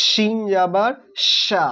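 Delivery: "শিন" and "সা" with a strong whistling sh; শিসের মতো আওয়াজ. Shown as a demonstration. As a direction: hiss a heavy sh in "শিন জাবার সা". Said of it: "সিটি মেরে আওয়াজ হবে". ("শিন জাবার সা"-তে শিস দেওয়া শ)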